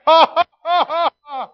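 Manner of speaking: loud high burst of real laughter; big laugh. Real, not acted. Preacher laughing at his father's lie, loud high laugh.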